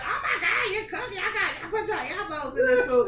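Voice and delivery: put-on high, excited teen voice; imitating her son. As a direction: mimic the hungry boy's delight. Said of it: "he was like".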